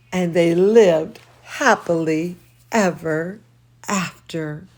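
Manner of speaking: lively read-aloud voice; storybook reading for children; story reading. A narrator's reading. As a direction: read to the kids with expression. Reads picture book aloud.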